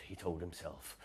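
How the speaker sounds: ordinary delivery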